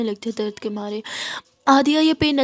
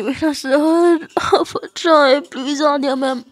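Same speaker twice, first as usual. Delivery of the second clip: pained plea; 4 semitones higher, 6 dB louder, slower than usual. Acted. Hungover girl pleads, head aching.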